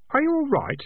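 put-on boy checking on the rescued rat; Keith's voice. A narrator's reading. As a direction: ask it anxiously. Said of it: "said Keith".

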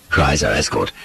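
usual speaking voice

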